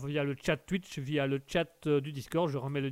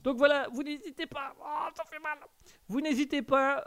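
high strained voice; 9 semitones higher than usual. Real, not acted. Talking while cat claws him.

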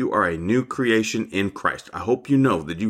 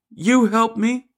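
put-on very high child's plea, held out; childlike faith; 14 semitones higher, slower than usual. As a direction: plead like a little child, pitched right up. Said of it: "with childlike faith".

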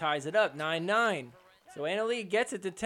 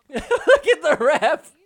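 real laughter, higher and louder; laughing; 8 semitones higher, 10 dB louder than usual. Real, not acted. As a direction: laugh through the words at the ref. Laughing at ref picking leaves.

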